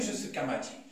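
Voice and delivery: ordinary talk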